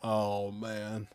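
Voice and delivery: ordinary talk, nothing marked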